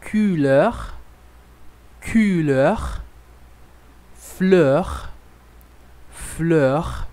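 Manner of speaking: each word said slowly and clearly, with pauses to repeat; careful French teacher drill; French pronunciation drill. Shown as a demonstration. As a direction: model French words for the class to echo. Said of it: "Então, vamos repetir".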